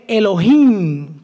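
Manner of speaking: Elohim with the final I stressed. Shown as a demonstration. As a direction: lean on the last syllable of Elohim, the I. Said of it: "con acento en la I". (Elohim con acento en la I final)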